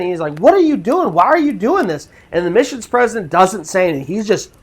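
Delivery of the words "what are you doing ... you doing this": higher-pitched mock yell of protest; retold screaming. Freaked-out passenger yelling at the speeding driver, retold screaming. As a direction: imitate a freaked-out demand, pitch jumping up. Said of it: "he's screaming at the president".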